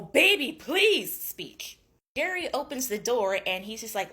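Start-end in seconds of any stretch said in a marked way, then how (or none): 0.0-1.2 loud, high, exaggerated begging; mock pleading. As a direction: ham up a lover's desperate plea. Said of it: "going through the whole".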